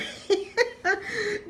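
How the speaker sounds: real high giggle. Real, not acted. Laughing at her own accidental innuendo, a high real giggle. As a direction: let a surprised giggle escape.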